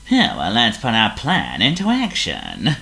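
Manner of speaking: oily, scheming villain voice; smooth and slimy, plotting. A narrator's reading. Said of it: "he said in an oily voice".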